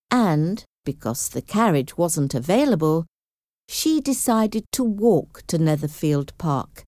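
plain reading voice

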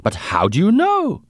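put-on high, astonished German-accented question; strong German accent. A narrator's reading. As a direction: ask in amazement with a thick German accent. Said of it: "He had a strong German accent".

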